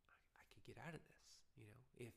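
hushed, scheming whisper; tempted whisper. Pretend. The parent, secretly tempted to copy the friend, whispering.

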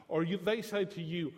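normal talking voice

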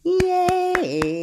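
high, drawn-out yay; little celebration for a baby; playful cheer. Pretend. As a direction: cheer in a long high sing-song. Cheer after the hello game.

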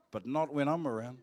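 ordinary delivery

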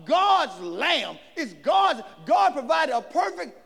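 loud, rising sermon climax; full-force preaching. Acted. Preacher proclaiming Jesus to the church. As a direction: drive it home to the congregation.

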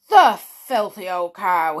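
loud furious insult; angry shout. A narrator's reading. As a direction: spit it out in rage. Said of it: "he shouted".

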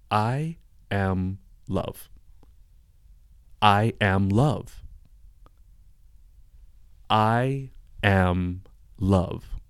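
very slow, calm, drawn-out mantra; slow repeated mantra. Retold or imitated. Coming out of the trance, repeating it slowly to friends. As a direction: let each I am love hang in the air. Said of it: "Listen to how Maddie is saying".

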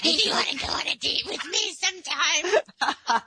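host echoing the cringey date request, mocking; mocking awkward ask-out. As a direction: overdo the creepy guy's request. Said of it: "Especially in that tone".

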